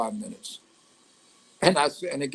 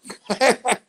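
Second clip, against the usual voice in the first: a short high laugh at his own bad luck; rueful laugh; 9 semitones higher, 3 dB louder than usual. Real, not acted. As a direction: laugh it off, bitter but amused.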